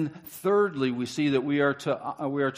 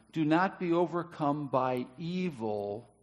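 solemn reading; slower than usual. Acted. Slow solemn verse reading.

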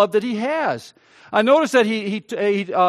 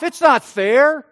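slow, loud "It's not fair" with "not" pushed up; a wounded, indignant cry of unfairness; loud protest; 4 semitones higher, 6 dB louder, slower than usual. Retold or imitated. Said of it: "but he answered his father".

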